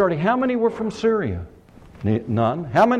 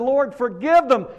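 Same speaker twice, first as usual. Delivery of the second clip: martyr's prayer, pitched high; Stephen crying out; 7 semitones higher than usual. Acted. Dying martyr prays for his killers, crying out. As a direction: cry out to God in a raised pitch. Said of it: "he cries out and said".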